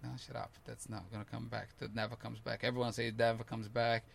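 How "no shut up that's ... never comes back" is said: much softer, firm talking-to yourself; quiet self-reassurance. Retold or imitated. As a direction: hush your own fear under your breath. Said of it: "It's like".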